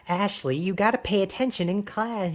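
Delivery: nagging parent voice. Retold or imitated. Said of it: "say the line in a stupid voice".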